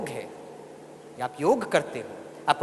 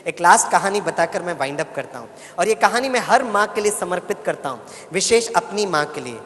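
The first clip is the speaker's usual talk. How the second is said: ordinary delivery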